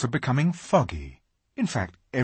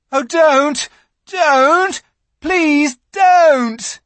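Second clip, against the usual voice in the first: high, loud desperate plea; frantic boy shouting; 12 dB louder, much slower than usual. A narrator's reading. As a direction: beg her to stop. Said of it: "shouted Edmund".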